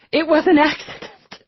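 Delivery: high, loud tearful protest; anguished denial. Acted. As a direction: wail it in distress. Weeping mother denies daughter's guilt.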